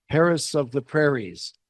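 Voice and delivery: ordinary talk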